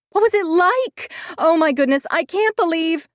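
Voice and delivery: high, loud, breathless friend voice; excited gushing. A narrator's reading. Friend gushing for kiss details. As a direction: gush like a thrilled best friend.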